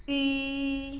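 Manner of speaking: long, high, loud "I" through rounded lips; "I" said as a drawn-out ü; pouted-lip ü. Shown as a demonstration. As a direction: pout and hold "I" between i and u. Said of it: "bibir seksi dia ya".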